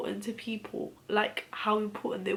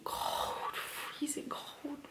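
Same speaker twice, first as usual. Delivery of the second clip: hushed grief; 6 semitones higher, 5 dB quieter, much slower than usual. Real, not acted. Remembering her father's body.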